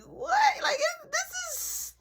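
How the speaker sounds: very high, drawn-out disbelief; incredulous. Retold or imitated. Teller reacts to mom partying on, incredulous. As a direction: squeak it out, stretched and stunned.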